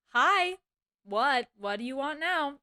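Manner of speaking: mock annoyed, punched-out greeting; retold curt greeting. Employee answering boss calling back, impatient. Retells answering boss's second call.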